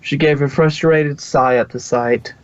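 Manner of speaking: plain reading voice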